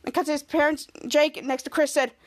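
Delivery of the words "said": usual speaking voice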